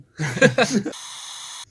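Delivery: bursts into high laughter; cracking up at the memory; loud laugh. Real, not acted. Remembering the empty cup.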